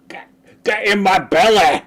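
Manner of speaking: high, loud greedy monster voice; hammed-up hungry glutton; greedy gobbling. Streamer craving soda, booming greedy glutton voice.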